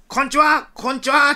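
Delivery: put-on high children's call; big-voiced greeting. Children saluting as they greet police, big-voiced hello. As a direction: sing out hello like saluting kids. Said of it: "振って声で".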